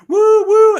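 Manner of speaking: two high, loud whoops; excited whooping. Retold or imitated. Recalling joyful Oscar winners of the past, excited whooping. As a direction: imitate a thrilled winner's "woo".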